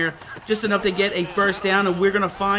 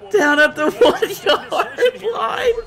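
higher, slow laughing call; giddy laughing commentary; 11 semitones higher, slower than usual. Real, not acted. Laughing at near score.

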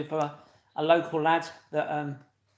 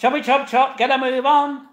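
mock hurry-up; 9 semitones higher, 11 dB louder than usual. Teasing chant at commenter.

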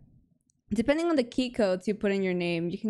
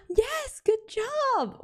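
much higher, delighted gasp; excited; 14 semitones higher, much slower than usual. Real, not acted. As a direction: gasp and cheer them on. Gasps, proud of chat member.

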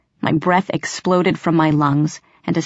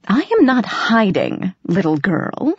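amused cat; 3 semitones higher than usual. A narrator's reading. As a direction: tease the lost girl, savouring it. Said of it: "The voice sounded amused".

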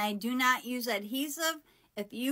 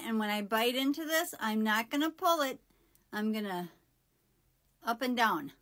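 talking while biting down; speaking around held food; chocolate between teeth. Real, not acted.

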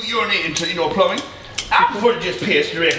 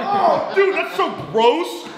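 disgusted; 12 semitones higher, slower than usual. Real, not acted. Reacting to gross taste.

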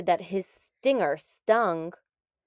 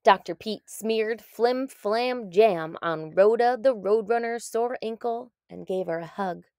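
usual speaking voice

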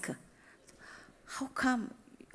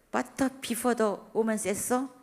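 ordinary talk, nothing marked

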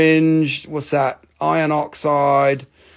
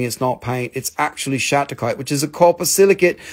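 usual speaking voice

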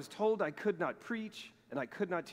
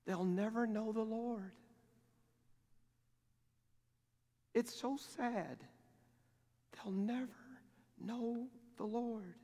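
softer, slow, a hint of crying; mild tearful lament; 7 semitones higher, 5 dB quieter than usual. Missionary's wife mourns the villagers at the festival, softly and slowly. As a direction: quietly grieve over the lost villagers. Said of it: "she's like".

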